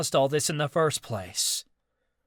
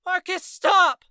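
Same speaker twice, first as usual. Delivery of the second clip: much higher, louder slow yell; 8 dB louder, slower than usual. Acted. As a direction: yell at him to stop. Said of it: "Ava yelled".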